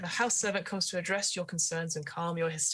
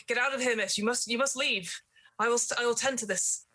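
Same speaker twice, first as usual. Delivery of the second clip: higher, pressing servant telling the miss to flee; urgent servant; 6 semitones higher than usual. Acted. Servant urging the trapped miss to leave, pressing. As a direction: push her to leave, urgent. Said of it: "the servant is like".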